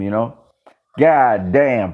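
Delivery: normal talking voice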